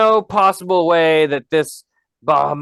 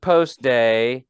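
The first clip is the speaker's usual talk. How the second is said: slow, dragging list of days; slow drawn-out list; much slower than usual. Pretend. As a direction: drag each day out. Listing workdays slowly.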